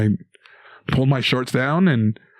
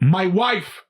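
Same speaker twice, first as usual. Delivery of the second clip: blurts it out, higher; mock yell; 6 semitones higher than usual. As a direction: yell it out in a sudden panic. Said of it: "I just yell out".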